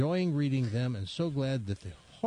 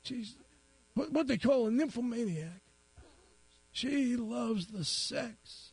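put-on high, teary old man voice; 8 semitones higher than usual. Crying old man tells the policeman his news, high and weepy. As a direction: play a weepy old timer. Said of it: "He says".

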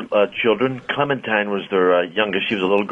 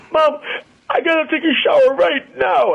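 put-on much higher, louder kid voice; boy calling to his mom in a mock panic; panicked little boy; 3 dB louder than usual. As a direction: whine it like a kid who needs a shower now. Boy panicking after being peed on.

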